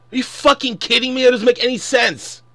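a furious fan ranting about the Jedi, loud and fast; real angry. Real, not acted. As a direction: fire it off in disbelief. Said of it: "I know I sound mad because I am mad".

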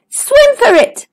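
high, urgent warning; alarmed cry. Acted. Stanley warns friends of the whale, urgent high cry. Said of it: "cried Stanley".

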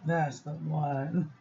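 lingering over the words; slow, low, savoring. Real, not acted. Vlogger savoring her favourite ink, slow and low. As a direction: draw it out low and slow, with relish.

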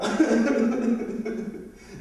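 high giggle; laughing at faked crying. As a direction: burst out laughing. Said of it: "je fais semblant".

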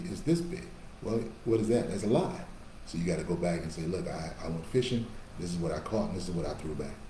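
ordinary delivery

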